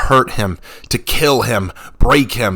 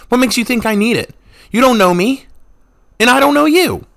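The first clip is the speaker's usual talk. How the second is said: much higher and louder, furious; angry shouting; 6 dB louder than usual. A narrator's reading. Revna shouts at the monk she doesn't know. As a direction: yell him away. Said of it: "she snapped back".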